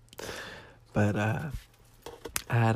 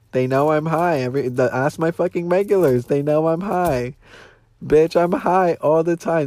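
louder and higher, insisting; emphatic insistence; 4 semitones higher, 6 dB louder than usual. Real, not acted. Fired server insists to viewers everyone knew, louder. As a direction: hammer the point home defiantly.

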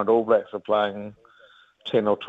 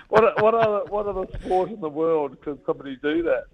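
usual speaking voice

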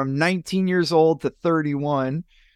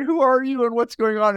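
retold bewilderment; 10 semitones higher than usual. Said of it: "I'm thinking in my mind".

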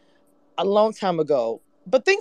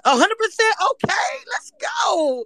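excited shout. Real, not acted. Host hyping up the guests with an excited high shout. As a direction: cheer it out, really pumped.

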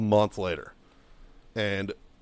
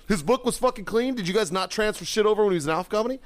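high-pitched rapid questioning; incredulous outrage; 9 semitones higher than usual. Retold or imitated. Marine confronting first sergeant over records, incredulous outrage. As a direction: challenge him in squeaky disbelief.

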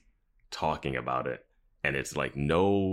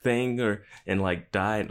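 high-pitched, lightly imitated panic; retold freak-out; 6 semitones higher than usual. Host retelling Anya's worry about an electrical accident, mock freaking out. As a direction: mimic someone freaking out, pitch jumping up at the start. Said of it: "Anya's freaking out".

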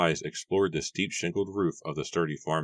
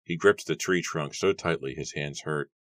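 ordinary delivery, nothing marked

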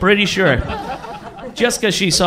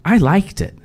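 his own voice; 4 semitones higher than usual. Retold or imitated. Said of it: "for the first time in his own voice".

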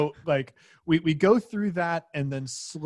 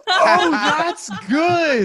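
laughing out the words, slow and high; 10 dB louder, much slower than usual. Real, not acted. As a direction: crack up while saying it. Group cracking up at joke.

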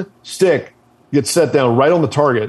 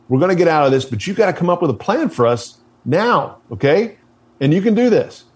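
higher-pitched, insistent pep talk; urgent steadying; 6 semitones higher than usual. Retold or imitated. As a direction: talk a panicked comrade down, point by point. Acting teammate steadying panicked leader.

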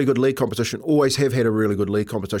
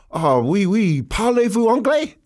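higher, eager phrasebook French; confused reply; 9 semitones higher than usual. Retold or imitated. Tourist answers French stranger, higher mock-eager confusion. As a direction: act out a puzzled tourist replying.

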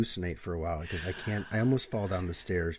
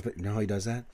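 ordinary talk

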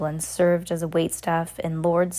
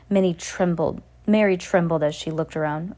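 plain reading voice

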